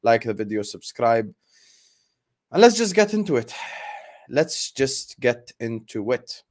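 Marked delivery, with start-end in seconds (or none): 2.5-3.5 high and loud, launching in; bursting to start the rant; fired-up. Real, not acted. Host to viewers, fired up to begin the rant: loud and high. 4.3-6.4 slow, weighted repeat; slow and deliberate. Real, not acted. Host to viewers, repeating himself grimly: slow and heavy.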